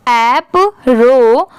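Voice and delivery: ऐप, रो very slow and separate; syllable by syllable. Shown as a demonstration. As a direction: stretch ऐप and रो as two parts. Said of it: "पहले कुछ part में divide करेंगे". (ऐप, रो धीरे-धीरे तोड़कर)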